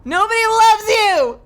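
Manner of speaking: high, loud frantic yell; panicked yelling. Real, not acted. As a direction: yell it at the monster. Yelling at monster.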